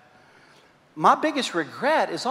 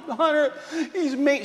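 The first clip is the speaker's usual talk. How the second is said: put-on higher whiny child's voice; upset little girl; 8 semitones higher, 4 dB quieter than usual. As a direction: complain like a hurt five-year-old.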